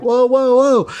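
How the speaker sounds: retelling the collision with a startled cry; startled whoa. Retold or imitated. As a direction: jolt up like the board just hit someone. Startled by hitting the surfer.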